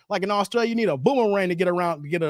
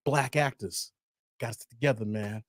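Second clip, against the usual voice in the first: low hushed skit voice; 9 semitones lower, 6 dB quieter than usual. Retold or imitated. Retelling Chappelle skit voice.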